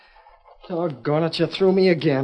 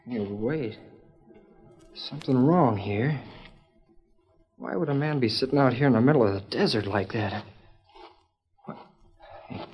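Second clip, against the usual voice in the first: softer, wary voice; hushed unease; 2 semitones lower, 6 dB quieter than usual. Acted. Boy alone in the desert wonders quietly about a stranger.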